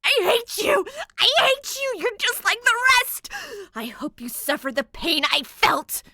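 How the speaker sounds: very high, loud screaming tantrum. A narrator's reading. Ghost bride screaming hatred at Danny. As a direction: shriek it with pure hate. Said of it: "The girl just screams".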